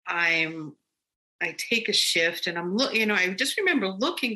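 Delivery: ordinary talk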